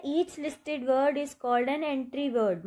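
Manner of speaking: slow, careful read of an English definition; reading aloud. Shown as a demonstration. Teacher reading a dictionary line to students, slow and deliberate. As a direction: read it out slowly for the class. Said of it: "reading dictionary definition aloud".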